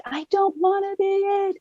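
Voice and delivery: high, mock-wailing child's scream; retold wailing scream. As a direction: imitate a kid screaming through tears, pitched high. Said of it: "was just screaming".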